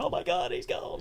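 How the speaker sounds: soft, breathy mock sob; a high, hushed imitation of weeping; mock breakdown. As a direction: parody her tearful breakdown. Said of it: "her having this breakdown".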